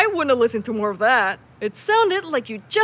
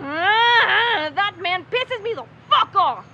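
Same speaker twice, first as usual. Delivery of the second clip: frustrated fuming; 8 semitones higher, slower than usual. Acted. Fuming after argument.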